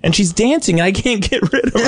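high, distraught mother impression; frantic mom. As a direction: wail helplessly as a flustered mom. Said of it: "frantic. She's crying".

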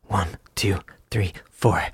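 hushed count-in before the jingle; whispered one two three four. Pretend. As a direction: breathe the count in.

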